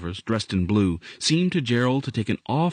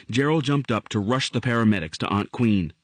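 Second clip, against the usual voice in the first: ordinary delivery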